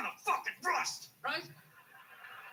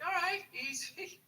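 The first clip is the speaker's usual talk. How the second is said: calming trainer; 7 semitones higher, slower than usual. Retold or imitated. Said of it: "It's like".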